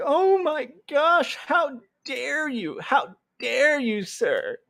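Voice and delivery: high, slow, prissy gasp of offence; mock fragile outrage. Mocking a fragile person who is challenged, high and slow. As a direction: play a clutching-pearls snowflake. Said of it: "all fragile about it".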